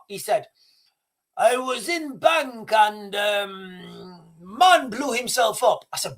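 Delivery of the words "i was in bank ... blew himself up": mock a flustered colleague in broken English. Colleague blurts out the bank news to his boss in broken English. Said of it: "It was broken English".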